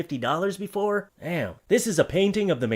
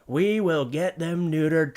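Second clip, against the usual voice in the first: ordinary talk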